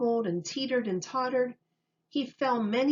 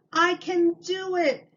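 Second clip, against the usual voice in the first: high proud shout; child shouting his success; triumphant shout; 9 semitones higher, 4 dB louder than usual. A narrator's reading. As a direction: yell it with pure pride. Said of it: "he shouted".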